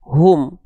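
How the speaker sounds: "Hum" closing on a held m; hu joined to m. Shown as a demonstration. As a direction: blend hu into a hummed m in "Hum". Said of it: "ditambah em".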